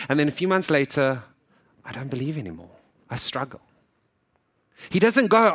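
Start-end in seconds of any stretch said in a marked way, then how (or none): 1.8-3.6 soft, subdued retold confession; deflated. Believer months after Holy Week, acted quiet discouragement. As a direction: quietly act out a loss of faith.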